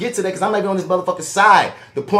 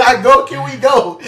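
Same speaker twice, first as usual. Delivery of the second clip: loud, high mock child pleading; imitating little girl; 9 semitones higher, 7 dB louder than usual. Host voicing the girl's look, mock pleading. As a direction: play a kid itching to leave. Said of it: "That's all that look up".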